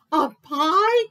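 high, loud dismayed groan; groaning bear. A narrator's reading. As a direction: groan it out in horror. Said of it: "groaned Bear".

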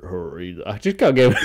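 shouted words breaking into a high laugh; can't hold the laugh in; bursting out laughing. Real, not acted. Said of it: "I know it's mean".

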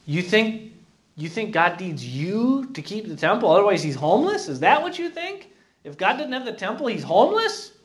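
high, rising rhetorical questions; mock incredulity. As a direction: challenge them as if the idea is absurd. Mocks the listeners' belief with questions.

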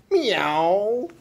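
loud, high cat yowl; yelled meow. A narrator's reading. Cat yells amid the noise, loud high meow. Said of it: "Coral yelled".

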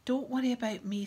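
trying to sound offhand, a bit loud. A narrator's reading. Boy reassuring his departing friends, would-be offhand. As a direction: brush it off with forced ease. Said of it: "hoped was an offhand voice".

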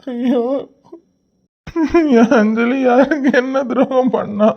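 slow tearful lament; crying. Acted. Grieving over Anjali to his friend, slow tearful lament. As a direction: wail the question out slowly. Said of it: "அழாதடா".

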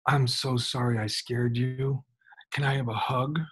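put-on kneeling to a scared boy, tender whisper; gentle whisper. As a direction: hush it tenderly to a frightened child. [name] gently consoles scared boy.

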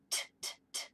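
short unvoiced T clicks; crisp T taps. Shown as a demonstration. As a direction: tap out "T" like a tooth. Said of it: "tap his tooth like this".

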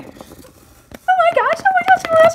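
very high fangirl squeal; giddy gushing. Acted. Girls gush after Tate leaves, giddy squeal. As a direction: squeal it, swooning over a crush.

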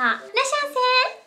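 high, slow shop clerk welcome; sing-song store greeting; irasshaimase greeting. Pretend. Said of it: "取り乱しました".

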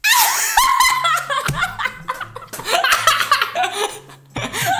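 loud high scream, then laughing; acted scream into laughter. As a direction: scream and dissolve into giggles. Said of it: "When you scream".

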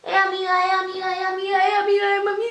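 a hint of a sing-song catcall from behind; imitating junkie's call. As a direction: mimic the drugged woman calling after her. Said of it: "Me está gritando".

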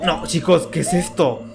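usual speaking voice

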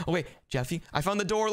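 usual speaking voice

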